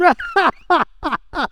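hearty demonstrative laugh; belly laugh demo. Shown as a demonstration. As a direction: show them a full-on belly laugh. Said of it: "I'm talking belly laugh".

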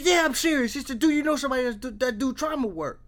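loud, high, exasperated retelling; imitated insistence. Brother insists after repeated disbelief.

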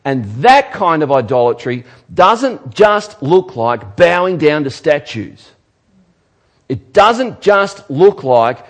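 loud, high impassioned preaching; preaching at full force. Acted. Pastor warning the congregation about idolatry, loud and high. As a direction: drive it home from the pulpit.

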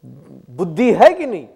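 uncle scolding a boy, loud mock outburst; father's scolding. Uncle to his young son over mischief, mock scolding. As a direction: imitate a loud scolding, swelling midway. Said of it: "scold him left and right".

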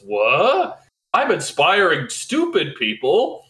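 slow, incredulous, rising question; mock dismayed disbelief. Batman realizing who copies him, hammed-up incredulous dismay. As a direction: let the realisation swing up in disbelief.

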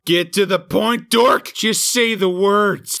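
high, loud badgering; impatient yell. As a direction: rush him, loud and high. Impatient mock yelling.

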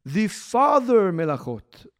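"father" drawn out slowly with weight; "father" stressed and stretched. Shown as a demonstration. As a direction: lean slowly on the word "father". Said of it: "I'm stressing the word Av".

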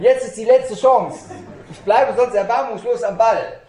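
loud, high shout to the room; booming rallying cry. Real, not acted. Speaker warns the audience it's their last chance, booming rallying shout. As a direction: belt it out as a relentless final challenge. Rallies audience, threatens to continue.

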